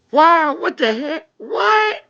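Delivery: high, drawn-out disbelief; real astonished cry; shocked exclamation. Real, not acted. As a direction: let the voice leap up on the last word. Shocked by what just happened.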